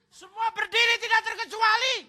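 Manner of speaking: high, loud commanding shout to stand; loud high mock command; mock song leader shout. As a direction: bellow the order like a pushy worship leader. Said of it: "Song leader teriak".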